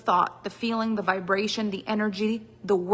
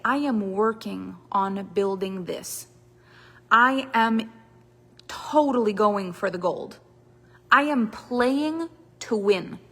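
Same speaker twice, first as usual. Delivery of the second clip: slow, deliberate, every phrase placed; slow determined declaration; slower than usual. Shown as a demonstration. Coach showing how a committed person speaks, slow and firm. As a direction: say it like you're going for gold. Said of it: "When you speak like that".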